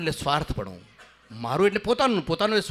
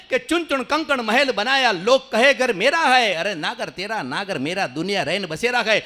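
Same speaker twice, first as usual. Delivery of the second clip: higher, louder chanted couplet; declaimed Hindi verse; 3 semitones higher, 4 dB louder than usual. Shown as a demonstration. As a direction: proclaim it to the crowd.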